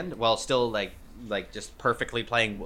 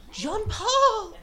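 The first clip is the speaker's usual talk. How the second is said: very high, squealed call; goblin yelling out. Acted. Blue goblin calls to an old friend, high excited yell. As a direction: holler in a high little goblin voice. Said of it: "a voice, uh, yell out".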